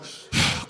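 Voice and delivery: long unvoiced sigh; weary sigh. Kid fed up with questioning, weary sigh. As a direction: let out a tired sigh. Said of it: "I'm just sighing".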